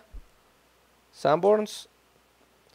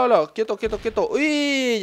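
usual speaking voice

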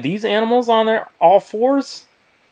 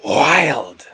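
tiger voice; 4 semitones lower than usual. Shown as a demonstration. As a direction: growl wild like a tiger. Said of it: "say it like a tiger".

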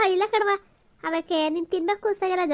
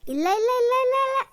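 much higher, rushed reassuring no no no; eager denial; 8 semitones higher, 4 dB louder than usual. Acted. Grandmother hurriedly assuring the child she isn't scolding, high and eager. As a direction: quickly soothe her, no scolding.

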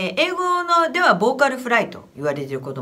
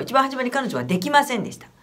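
ordinary talk

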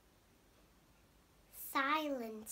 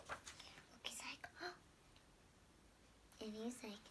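whisper; 11 dB quieter than usual. Real, not acted.

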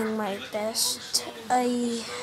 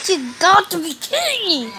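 loud, higher exasperated yell; real gamer rage shout; frustrated shout; 6 semitones higher, 5 dB louder than usual. Real, not acted. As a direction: yell it at the screen in disbelief.

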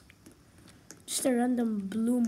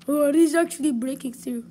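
terrified horror victim; 2 semitones higher, 8 dB louder than usual. Real, not acted. Monster breaking through.

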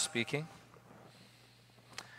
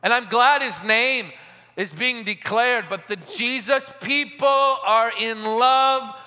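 raised, ringing pulpit voice; fervent preaching; 5 semitones higher, 4 dB louder than usual. Acted. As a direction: preach it out to the crowd. Preaching at full force.

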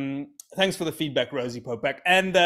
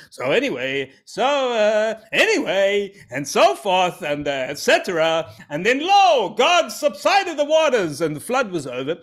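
a hammed-up Josh accent, higher and louder; 6 semitones higher, 6 dB louder than usual. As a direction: put the Josh accent back on with bravado. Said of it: "forgotten about my Josh accent".

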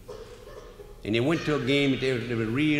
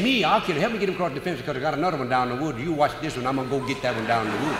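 hurried Murphy; faster than usual. Retold or imitated. Murphy hurrying off from the warden.

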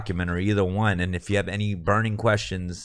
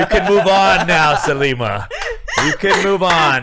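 higher, louder, slow laughing cheer; 9 semitones higher, 6 dB louder, slower than usual. Retold or imitated. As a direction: laugh it out, happy for her. Retold congratulations, laughing.

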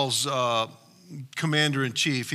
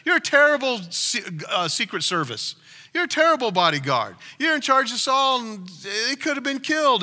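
mocking; 7 semitones higher than usual. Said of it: "he's making fun of him".

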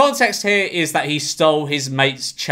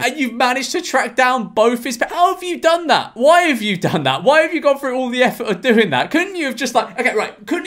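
higher, disbelieving rapid questions; incredulous; 8 semitones higher than usual. Real, not acted. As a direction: pile on the disbelief, pitch climbing. Incredulous at tracking down parents.

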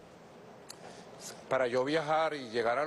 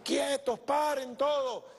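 high, stretched-out order to a crowd; high-pitched commanding mock yell; retold shout; 11 semitones higher, slower than usual. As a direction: re-enact calling everyone to a halt. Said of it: "grité yo".

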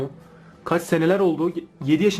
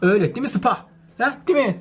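talking to pet; 6 semitones higher than usual. Real, not acted. Talking to the dog Sıpa.

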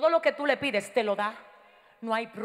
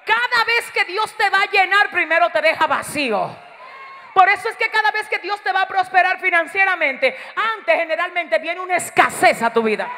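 higher, louder sermon delivery; raised-voice preaching; 5 semitones higher, 7 dB louder than usual. Real, not acted. Preacher telling church that emptiness comes before provision, raised-voice preaching. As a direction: preach it with a lifted, insistent voice.

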